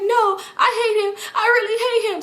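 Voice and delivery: high, whiny, half-sobbing imitation; mock crying friend. Leslie complaining about the man, high, mock tearful. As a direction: whine it like she's near tears.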